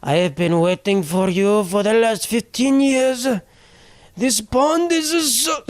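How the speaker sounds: high, drawn-out turtle plea in a hammed-up Japanese accent; slow, climbing put-on Japanese accent, pleading; mock Japanese accent. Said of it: "He speaks with a Japanese accent".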